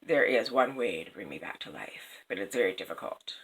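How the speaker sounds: low, soft whispered statue voice; eerie whisper. Acted. The statue whispering to the weeping king, eerie. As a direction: breathe it out like a stone speaking. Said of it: "the statue said".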